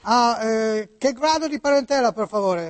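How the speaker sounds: put-on Italian spoken with an American accent. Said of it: "come parla Niculo? ... oggi americani".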